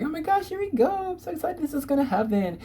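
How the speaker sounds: high, giddy voice; excited squeal. Retold or imitated. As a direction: gush like the big news just landed. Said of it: "being like".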